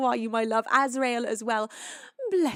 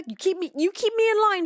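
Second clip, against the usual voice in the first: much higher and louder, exclaimed; loud playful thanks; 11 semitones higher, 9 dB louder than usual. Real, not acted. Thanking Shocker for keeping her on task, exclaimed. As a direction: call it out brightly to the chatter.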